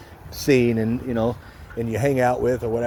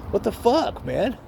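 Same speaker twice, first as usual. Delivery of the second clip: pissed off; 9 semitones higher than usual. Retold or imitated. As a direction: re-enact being irate without shouting. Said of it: "now I'm pissed".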